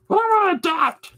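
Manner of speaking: very high cartoon cat squeal; squeaky cat. Cat insists to owner on adopting, squeaky. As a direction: squeal the demand like a tiny cat. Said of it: "he does voices".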